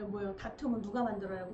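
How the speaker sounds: usual speaking voice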